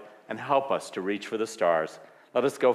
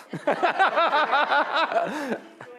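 high laugh; 3 dB louder than usual. Real, not acted. Laughter at pancake moment.

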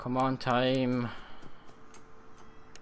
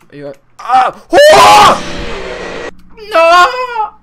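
much higher and louder, shrieking in panic; real panicked screaming; 19 dB louder, much slower than usual. Real, not acted. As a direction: scream as the monster gets you. Jump scare, dying in game.

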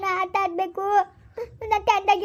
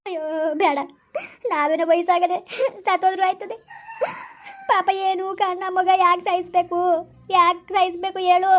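weeping protest; grief-stricken appeal, words broken by crying; tearful pleading. Acted. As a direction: plead through sobs for the child's life. Weeps, pleads to spare the child.

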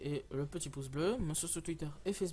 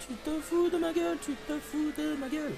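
high exasperated whine; 11 semitones higher than usual. Real, not acted. Repeats it much higher.

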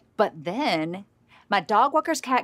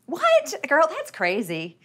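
mock shock; 5 semitones higher, slower than usual. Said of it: "I'm just gonna stop you right there".

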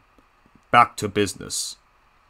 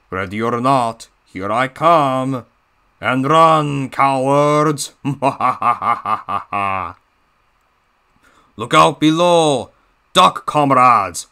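louder Russian-tinged villain taunt; a hint of a Russian heavy mocking cowards; gleeful Russian-accented threat; Diesel 10 Russian accent; 2 semitones higher, 3 dB louder, slower than usual. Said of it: "my Diesel ten voice impression of him sounding all Russia".

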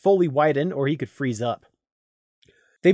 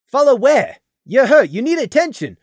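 higher, urgent worried reply; alarmed; 9 semitones higher, 6 dB louder than usual. A narrator's reading. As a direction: respond in alarm. Alarmed reply to wounded soldier.

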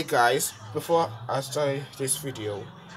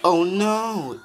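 high loud gasp of alarm; a puppet's loud oh no; shocked cry; 9 semitones higher, 8 dB louder than usual. Acted. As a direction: cry out in a higher voice. Sees the villain's victims.

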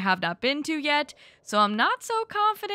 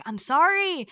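higher, louder mock apology; playful apology; 6 semitones higher, 6 dB louder than usual. Real, not acted. Player excusing her rush to marry Mark, playfully apologetic. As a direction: exclaim it as a cheeky excuse.

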